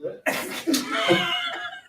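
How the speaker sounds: sudden high laugh; high burst of laughter. Real, not acted. Host cracking up during the countdown, a high laugh.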